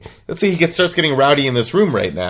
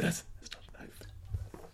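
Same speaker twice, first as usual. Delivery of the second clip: slow, voiceless mimicking whisper; imitated whisper; 25 dB quieter, slower than usual. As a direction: whisper it slowly, mimicking his muttering. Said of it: "what you're whispering a second ago".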